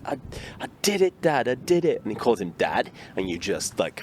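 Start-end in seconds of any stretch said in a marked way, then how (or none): 0.1-2.0 put-on high excited kid voice, much higher; kid's triumphant cry. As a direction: imitate a boy proud he finally rode the bike. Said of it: "He's like".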